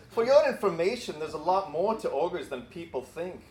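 amateur Scottish brogue for Shrek, half laughing; attempted Scottish accent. Pastor reading Shrek's line to Donkey in a clumsy Scottish accent. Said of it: "read this in the Scottish accent that Shrek has".

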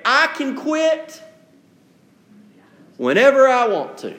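mock addict's confident claim; boastful addict. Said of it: "an alcoholic can always say".

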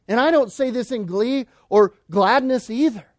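grieving preaching at full force. Acted. Preacher warning the church about counterfeit faith, preaching at full force. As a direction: warn them as if millions hang on it.

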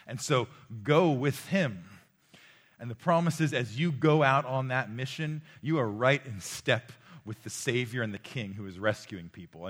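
loud, driving preacher's proclamation; preaching at full force. Acted. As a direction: press the claim hard on the crowd.

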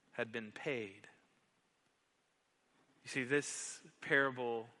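usual speaking voice

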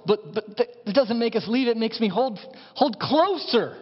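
loud, high, impassioned preaching; full-force preaching. Real, not acted. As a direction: preach it out with rising fervour.

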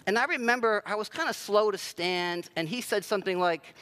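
ordinary delivery

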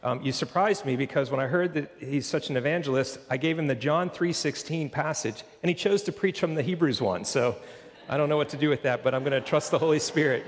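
ordinary talk

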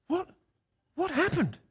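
high, hushed bewilderment; baffled. Retold or imitated. Travellers looking at each other back in the vans, high-pitched bafflement. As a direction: squeak it out in mock bafflement. Baffled companions thinking after the rescue.

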